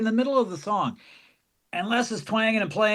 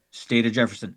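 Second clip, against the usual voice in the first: low announcer bit; 7 semitones lower than usual. Retold or imitated. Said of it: "and you went".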